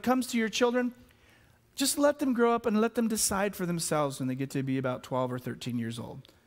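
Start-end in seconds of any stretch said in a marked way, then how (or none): 1.8-6.3 quick, offhand, trailing down low; mock laid-back shrug of a voice; dismissive mock advice. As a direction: rattle it off casually, sinking at the end. Voices the lax view he rejects.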